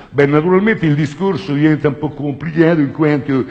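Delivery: high, loud affected professor voice; posh Roman professor. Retold or imitated. Comedian mocks his pompous professor friend, high affected voice. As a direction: put on airs like a pompous academic. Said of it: "perché se parla così".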